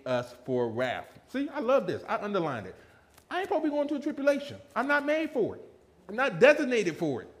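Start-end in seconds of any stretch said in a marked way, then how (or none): 3.3-7.3 high, fast, rising joy in the verse; excited preaching. Real, not acted. Preacher thrilled by the verse, excited. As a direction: preach it like good news. Preacher rejoicing over scripture.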